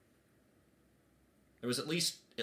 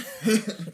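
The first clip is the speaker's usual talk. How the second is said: high burst of laughter; 10 semitones higher, 9 dB louder than usual. Real, not acted. Player reacts to eagle summoning.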